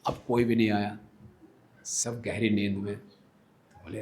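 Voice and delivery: normal talking voice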